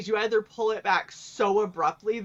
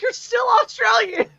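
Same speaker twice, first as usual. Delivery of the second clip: exasperated yell; 13 semitones higher, 7 dB louder than usual. Retold or imitated. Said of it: "and I was like".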